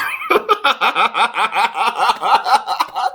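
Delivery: sudden high-pitched laugh. Real, not acted. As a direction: burst out laughing. Reacts to accusation.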